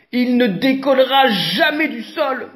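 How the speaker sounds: ordinary delivery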